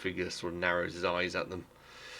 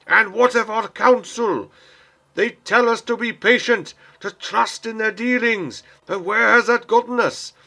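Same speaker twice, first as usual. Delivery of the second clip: high, loud, impassioned oration to the crowd; rabble-rousing shout; 14 dB louder than usual. Acted. Man on a crate rousing the crowd against the king, shouting. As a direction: bellow it from the crate, desperate and furious. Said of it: "as he shouts out".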